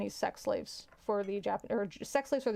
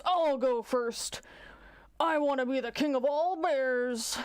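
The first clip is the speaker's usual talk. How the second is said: big drawn-out cartoon bear voice; pushy joke bear; 4 semitones higher, slower than usual. Bear wishing to be king, big pushy voice. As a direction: play a bear greedily barging in first. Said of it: "the bear pushes the rabbit and says".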